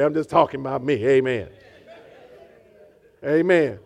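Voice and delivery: ordinary talk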